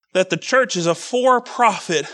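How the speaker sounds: ordinary delivery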